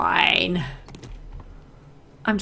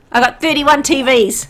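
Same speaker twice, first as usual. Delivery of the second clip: excited; 5 semitones higher, 8 dB louder than usual. Real, not acted. Bragging about loot count.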